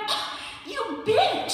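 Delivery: high, slowed, huffy mock outrage; the offended inner perfectionist; mock indignant outburst. Inner perfectionist insulted by the bear, mock indignant. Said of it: "perfectionist in me was kind of like".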